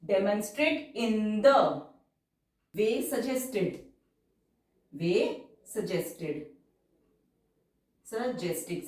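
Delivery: very slow, drawn-out dictation pace; stenography dictation, stretched syllables. Shown as a demonstration. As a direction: read it out word by word for shorthand. Dictates shorthand passage slowly.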